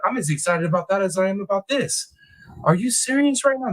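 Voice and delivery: high, eager disbelief; buzzing with true crime excitement; excited. Real, not acted. Streamer thrilled by news from chat, high excited disbelief.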